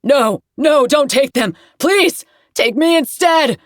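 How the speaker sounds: high, loud pleading shout, slow at the end; terrified yelling. Acted. Sin yelling in a nightmare, high terrified pleading shout. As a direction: beg them to take you instead, screaming. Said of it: "starts yelling".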